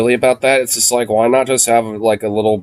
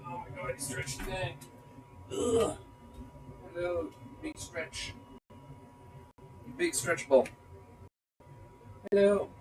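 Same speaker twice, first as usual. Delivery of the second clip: high, soft cooing at the dog; 9 semitones higher, 19 dB quieter than usual. Real, not acted. Owner cooing at his stretching dog, high and soft.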